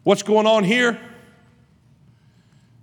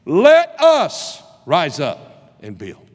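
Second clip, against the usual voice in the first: rallying cry; 4 semitones higher, 9 dB louder, much slower than usual. Acted. People's resolve quoted at climax.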